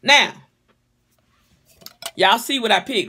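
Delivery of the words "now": ordinary delivery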